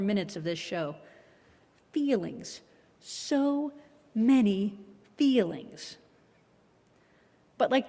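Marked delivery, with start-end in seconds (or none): 1.9-6.0 drawn-out wry sigh. Acted. Ironic refrain on show's emotions.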